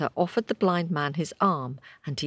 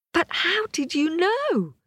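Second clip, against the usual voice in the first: high, amazed question; 13 semitones higher than usual. Acted. Hunter asks the blind man how he knew, amazed. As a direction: ask in wide-eyed wonder.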